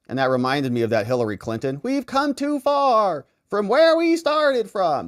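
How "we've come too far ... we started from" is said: higher, slow put-on Southern preacher accent; mock Southern accent. Mocking the candidate's put-on Southern accent. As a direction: drag it out in a mocking Southern drawl. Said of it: "when she started her little accent".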